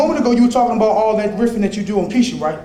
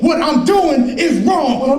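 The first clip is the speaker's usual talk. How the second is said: slow insistent demand; 7 semitones higher, 4 dB louder, much slower than usual. Acted. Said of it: "I say it".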